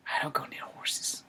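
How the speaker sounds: slow hushed whisper; low secretive whisper. Real, not acted. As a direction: confess it quietly, slowly.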